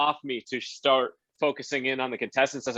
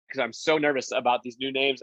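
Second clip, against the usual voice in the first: usual speaking voice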